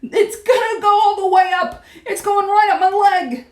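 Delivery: high, loud panicky yell. A narrator's reading. Cook panics as mouse climbs his leg, yelling. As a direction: holler in rising alarm. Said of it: "the man was yelling".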